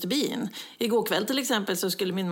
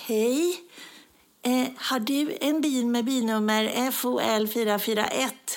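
slow, plodding imitation of a neighbour; imitated dull, unhurried speaker; slow-talking neighbour lady; 2 semitones higher, slower than usual. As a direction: drag the question out tediously. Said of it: "En dam som pratar så långsamt".

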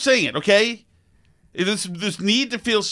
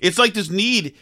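normal talking voice

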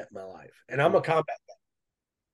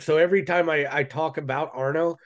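ordinary talk

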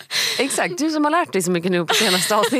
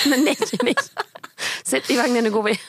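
laughing, exasperated nej nej; laughing mother; 6 semitones higher than usual. Retold or imitated. Mother to toddler, laughing through exasperation. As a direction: shoo the child into the pram while laughing.

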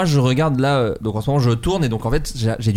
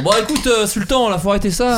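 mock pet owner; 7 semitones higher than usual. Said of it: "les gens qui font".

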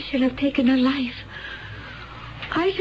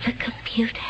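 soft, lower, breathed echo; stunned whisper; 6 semitones lower, 4 dB quieter, faster than usual. Acted. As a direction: whisper it in disbelief.

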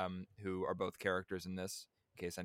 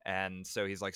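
ordinary talk, nothing marked

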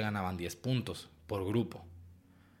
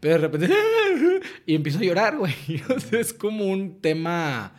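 laughing while talking; 7 semitones higher, 8 dB louder than usual. Real, not acted. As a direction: voice breaking into laughter. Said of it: "cagado de la risa". (hablando entre risas)

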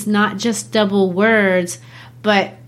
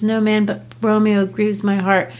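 ordinary talk

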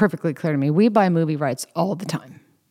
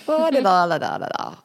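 blissed-out mumble; 3 semitones higher than usual. Retold or imitated. Erin recalling the craniology session, mock blissful humming. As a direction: mimic drifting off under a soothing massage. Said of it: "and you're just like".

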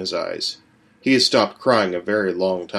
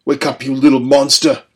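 loud, harsh father's shout; louder, higher brutal father; father's yell; 4 semitones higher, 6 dB louder than usual. A narrator's reading. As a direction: roar at the boy to wake. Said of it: "his father's voice said".

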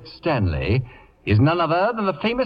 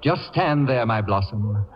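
normal talking voice

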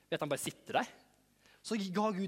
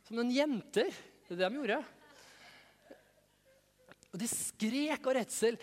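ordinary talk, nothing marked

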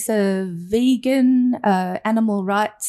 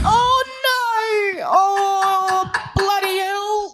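high crying wail of a dying whale; 8 semitones higher, much slower than usual. Acted. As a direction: sob and cry out like a stricken whale. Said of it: "so the dying whale was a bit like".

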